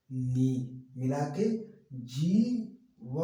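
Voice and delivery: slow syllables with a long जी; unhurried model reading; drawn-out reading demo. Shown as a demonstration. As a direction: stretch जी, व, नी one by one. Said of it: "कैसे पढ़ेंगे?".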